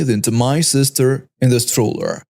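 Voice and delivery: ordinary delivery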